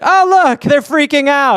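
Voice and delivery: mock the tiny humans; gloating giant ant. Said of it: "be like".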